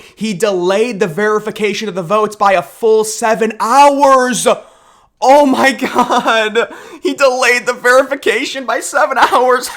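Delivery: high, loud, hammed-up outrage building to a wail; mock hysteria. Host mocking outrage over January sixth, hammed-up hysteria. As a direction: wail it in overblown horror.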